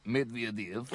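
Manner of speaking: lightly put-on Russian accent; slow the name down in a Russian accent. Naming the new world leader, mock Russian accent. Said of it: "You've got to put on the Russian accent".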